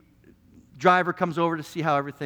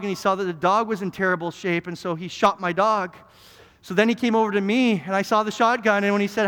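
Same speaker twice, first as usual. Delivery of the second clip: ordinary talk, nothing marked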